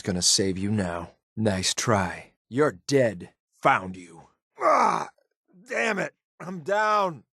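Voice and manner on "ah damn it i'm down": high, strained cry of a downed soldier; pained cry. Acted. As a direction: gasp it out as you fall. Downed in combat.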